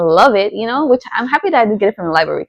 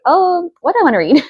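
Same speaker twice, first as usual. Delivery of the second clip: mock wondering; 9 semitones higher than usual. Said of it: "I was like".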